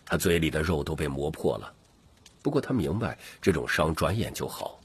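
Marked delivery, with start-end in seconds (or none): none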